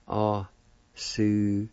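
Osuyesti very slowly, broken into syllables. Shown as a demonstration. Teacher repeating pick for learners, Osuyesti slowly by syllable. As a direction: draw out and separate each syllable of Osuyesti. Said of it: "it's pronounced like this".

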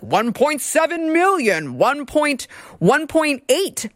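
slow, high, sarcastic mock reading of the numbers; mocking the media. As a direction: play a flustered anchor fumbling the figure. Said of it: "They'll be like".

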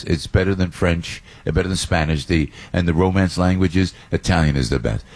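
ordinary delivery

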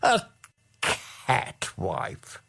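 soft, whispered scornful sneer. A narrator's reading. Signor sneers at the cat princess. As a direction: spit it out with contempt. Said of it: "sneered the Signor".

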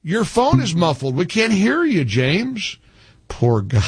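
ordinary talk, nothing marked

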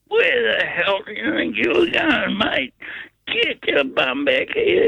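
high, slow put-on tough bloke calling his dog back; Kiwi hard man. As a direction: stretch it out high like a barefoot hard man scolding his pit bull. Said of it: "this guy turns around and says".

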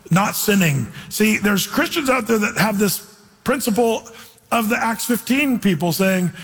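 normal talking voice

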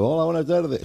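saludo de presentador, bastante más agudo; presentador de protocolo. Retold or imitated. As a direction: imita una cabecera de programa, con el tono muy arriba. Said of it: "el protocolo de presentadores". (voz aguda de presentador que abre programa)

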